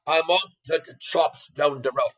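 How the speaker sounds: a light Irish accent, lightly put on. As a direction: say it like a friendly Irish local, lightly. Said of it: "with a little Irish, Irish accent".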